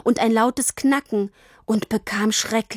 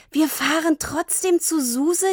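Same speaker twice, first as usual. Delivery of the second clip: higher, jubilant line; cheering; 6 semitones higher than usual. A narrator's reading. Girl hugging her father, cheering. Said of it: "jubelte Tini".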